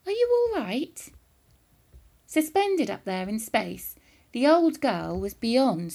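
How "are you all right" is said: much higher, innocent inquiry; mock-innocent polite boy; politely. A narrator's reading. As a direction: feign polite concern. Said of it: "asked her politely".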